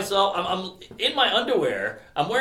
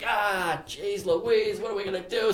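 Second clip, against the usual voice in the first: softer, mock-exasperated moan; retold exasperation; 3 semitones higher, 5 dB quieter than usual. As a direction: imitate an exasperated manager at a loss.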